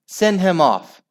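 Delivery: high, loud demand; howl. A narrator's reading. Father demanding action from the referee, howling. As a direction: howl for a sending-off. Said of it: "howled Al's father".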